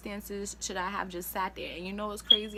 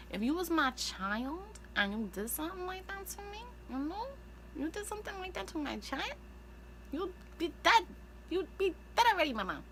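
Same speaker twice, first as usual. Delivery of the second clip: furious threat; 9 semitones higher than usual. Real, not acted. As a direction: threaten as a fierce mother. Furious threat about her child.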